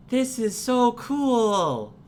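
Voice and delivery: high, slow, loud delight; a kid overjoyed with a gift; thrilled child. Acted. Girl wearing the bowler hat, high, slow and loud with delight.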